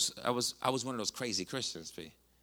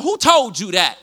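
loud challenge; 8 semitones higher, 15 dB louder, slower than usual. Retold or imitated. Retold challenge to grieving man.

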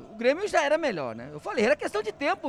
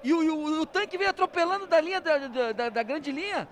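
excited; 6 semitones higher, 5 dB louder than usual. Real, not acted. Commentator excited after goal.

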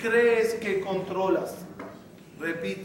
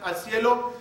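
ordinary talk

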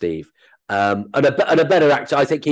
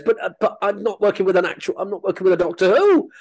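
high, petulant mock complaint; put-on disappointed moan, pitched up; self-mocking whinge; 9 semitones higher than usual. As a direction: grumble in a high inner voice, mocking yourself. Voices inner grumbling part of himself.

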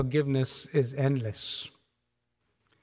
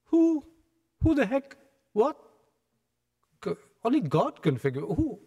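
much higher, sputtering outrage; outraged shock; 8 semitones higher than usual. Retold or imitated. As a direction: blurt out in shocked indignation. Scribes outraged at forgiveness.

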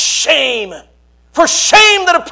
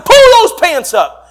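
loud, slowed mock order; retold yell; 8 semitones higher, 9 dB louder, slower than usual. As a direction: repeat the command firmly to the boy. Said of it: "I said".

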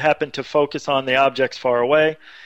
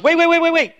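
much higher, louder rapid "wait"s; excited colleague; 14 semitones higher, 4 dB louder, faster than usual. Retold or imitated. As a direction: stop everyone, suddenly excited. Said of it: "one of the guys goes".

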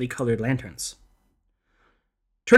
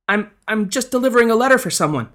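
nervous stammer, pitched much higher; 7 semitones higher, 3 dB louder than usual. A narrator's reading. Frightened youth answering the ghost lady, stammering. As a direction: stumble over the first words, scared. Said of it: "Lian stammered out".

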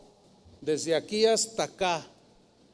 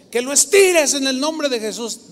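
loud, raised preacher's order; imitated insistent command; 6 semitones higher, 7 dB louder than usual. Preacher woman insists grandfather stretch his arm, imitated loud command. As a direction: insist forcefully, voice up and louder.

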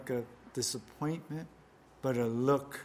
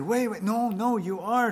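insisting accuser; 8 semitones higher, 5 dB louder than usual. Acted.

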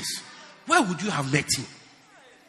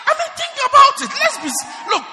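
much higher, loud laughing outburst; laughing shout; 8 dB louder than usual. Real, not acted. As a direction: burst out laughing mid-shout. Preacher laughing at congregation.

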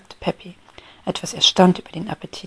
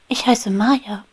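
usual speaking voice

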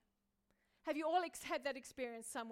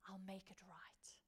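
slow, soft whisper; tender low whisper; whispered promise; 6 semitones lower, 13 dB quieter than usual. Retold or imitated. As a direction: whisper it gently to someone hurt. Jesus comforting the abused.

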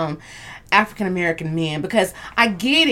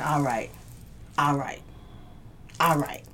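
low, soft retold surrender; subdued, low grudging agreement; giving in; 7 semitones lower, 12 dB quieter than usual. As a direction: back down quietly, pausing between each repeat.

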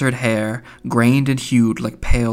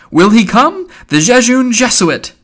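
very high, loud, gleeful exclamation; delighted cry; 11 semitones higher, 8 dB louder than usual. A narrator's reading. Mulligan crows over his friend the Jesuit, delighted cry. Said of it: "laughing with delight".

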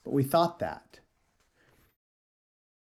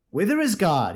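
raised, louder cry; cried question; 6 semitones higher, 5 dB louder than usual. Acted. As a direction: call out to the crowd. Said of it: "he cried".

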